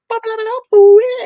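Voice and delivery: very high-pitched babble rising to a loud whoop; silly squealed gibberish to startle someone; stupid noises. Retold or imitated. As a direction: blurt out daft, shrieky nonsense noises. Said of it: "I make stupid noises".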